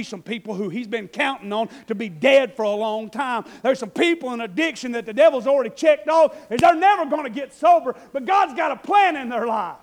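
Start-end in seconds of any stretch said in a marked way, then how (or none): 3.6-9.7 loud, high, fiery sermon voice; full-force preaching. Acted. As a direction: preach it out to the back row.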